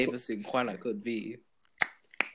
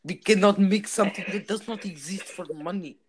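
a hint of a stern Russian accent, pushed a bit louder; Russian waiter accent; 4 semitones higher, 6 dB louder than usual. Waiter refusing the customer, light Russian accent. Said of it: "not being racist with this accent".

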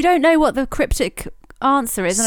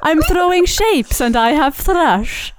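slow, higher, sing-song Welsh lilt; Welsh riddle voice; 5 semitones higher, slower than usual. Acted. Host continues riddle for giggling guests in strong Welsh accent. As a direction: ham up the Welsh riddle creature. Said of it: "Sounds really Welsh now".

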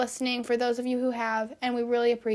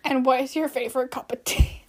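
loud mock British accent; 7 dB louder than usual. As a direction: ask it in an amateur British accent. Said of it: "start us off with a British accent".

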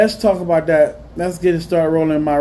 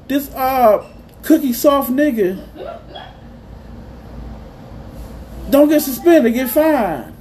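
fired-up outraged rant; heated rant; 6 semitones higher than usual. Real, not acted. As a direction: vent it, the anger climbing. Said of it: "Let me rant about this".

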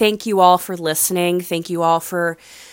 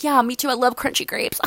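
a higher, softer sheepish agreeing voice; awkward reply; 6 semitones higher, 5 dB quieter than usual. Retold or imitated. Caught at the wine tray, answering the instructor sheepishly. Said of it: "I'm like".

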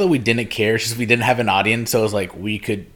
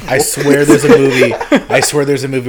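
louder, half-laughing protest; laughing insistence; 4 semitones higher, 9 dB louder than usual. Real, not acted. Insists on film while others laugh.

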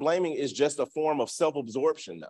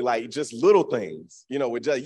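normal talking voice

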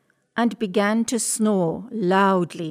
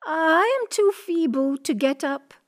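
put-on high, weak old-lady voice; high-pitched, helpless granny; feeble grandmother; 7 semitones higher than usual. A narrator's reading. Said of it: "cried the grandmother".

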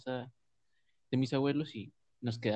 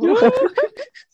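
shrieking laugh; 19 dB louder than usual. Real, not acted. Laughs at the retold shout.

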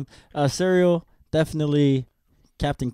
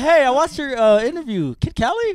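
high eager fan asking; excited fan voice; 12 semitones higher, 8 dB louder than usual. Retold or imitated. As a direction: play the fan who spots him. Fan recognizing him in public.

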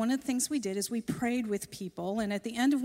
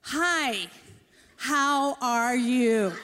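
slow loud greeting; 5 dB louder, much slower than usual. Retold or imitated. She thought I had a hearing problem.

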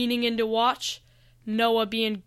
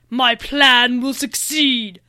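loud, slow villain's vow; menacing villain; 8 dB louder, slower than usual. A narrator's reading. Lane vowing to win over everyone, loud villainous declaration. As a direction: declare it slowly, with evil resolve. Evil dragon vows victory.

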